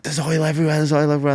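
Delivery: high, frantic repeating; freaking out. Retold or imitated. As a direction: panicky rider blurting it out twice. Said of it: "I'm freaking out".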